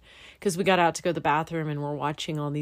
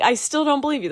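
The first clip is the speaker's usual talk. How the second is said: disbelief; 8 semitones higher, 5 dB louder, faster than usual. Retold or imitated. Retold disbelief at headlamp claim.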